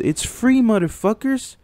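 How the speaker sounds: excited outburst. Real, not acted. Ranting at viewers, voice leaps up.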